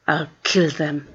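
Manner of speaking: low, soft seething mutter; boy muttering a threat after the bullies leave, seething; muttered through clenched teeth. A narrator's reading. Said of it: "muttered Sergei through clenched teeth".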